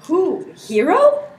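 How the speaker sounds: high, stunned questions; can't believe what he just heard; shocked disbelief. Acted. Claudio hears his bride called disloyal, shocked.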